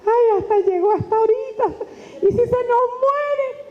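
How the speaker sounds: high-pitched mock alarm; worried listener. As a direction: fret as if the speaker might collapse. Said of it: "Tú dices".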